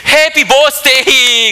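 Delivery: high, loud, slow Happy Birthday cheer; cheery singsong. Retold or imitated. American teacher cheering happy birthday at the granny, loud and drawn out. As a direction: stretch out a big Happy Birthday.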